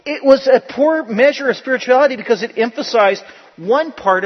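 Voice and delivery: loud, high, driving pulpit delivery; full-force preaching. Acted. Pastor hammering his point home to the church, preaching loud. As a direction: preach it hard and loud to the congregation.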